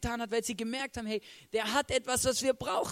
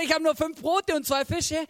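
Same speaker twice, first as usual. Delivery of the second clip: high, loud excuse; acted-out, raised-voice objection; protesting first reaction; 6 semitones higher, 5 dB louder than usual. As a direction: protest that you have too little. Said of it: "meine erste Reaktion war".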